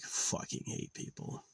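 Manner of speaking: unvoiced whisper of disgust; whispered aside. Real, not acted. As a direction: breathe it out bitterly. Muttered hatred.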